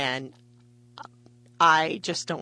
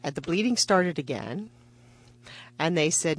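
normal talking voice

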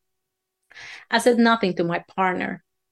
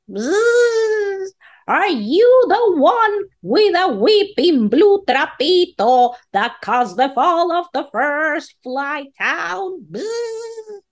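high, trembling buzzing insect voice; shaky fly voice; 8 semitones higher, 4 dB louder, slower than usual. Acted. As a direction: quiver like a frightened little fly. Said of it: "with a shaky voice".

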